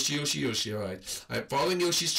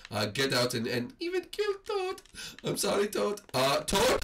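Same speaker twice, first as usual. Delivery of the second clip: ordinary talk, nothing marked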